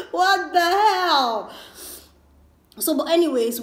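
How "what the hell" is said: amused disbelief. Real, not acted. Laughing at her own rant.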